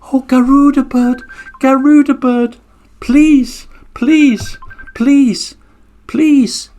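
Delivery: high, small pleading turtle voice; pleading little turtle. Acted. Little turtle begging the Garuda bird not to eat it, pleading high. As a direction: beg for your life as a tiny turtle.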